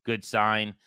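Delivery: ordinary talk, nothing marked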